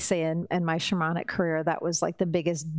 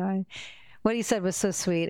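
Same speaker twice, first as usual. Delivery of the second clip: ordinary talk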